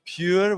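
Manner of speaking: "Pure" with a long pure oo and a sounded r; Scottish oo in pure. Shown as a demonstration. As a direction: stretch the oo in "Pure". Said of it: "with that oo sound".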